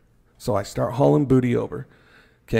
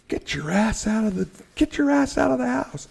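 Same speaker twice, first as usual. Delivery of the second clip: 1 chief's yell; 11 semitones higher than usual. Retold or imitated. Chief yells him out of fire.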